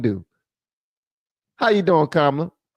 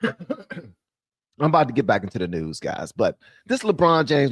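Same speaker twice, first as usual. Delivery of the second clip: usual speaking voice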